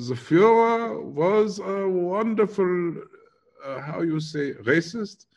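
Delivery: high, hammed-up German accent. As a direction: play an elderly German reminiscing, pitched high. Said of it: "keep going with the German".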